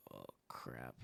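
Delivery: very soft, low whisper; hushed oh crap. Retold or imitated. Hunter spotting a giant buck at close range, hushed whisper. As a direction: whisper it so the deer won't hear.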